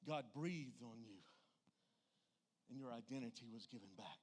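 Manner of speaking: normal talking voice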